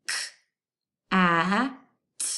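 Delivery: K, a, t said sound by sound. Shown as a demonstration. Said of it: "to the middle".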